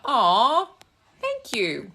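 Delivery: high, loud, touched aw; a real, delighted aw. Real, not acted. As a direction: melt at the compliment. Touched by a viewer compliment.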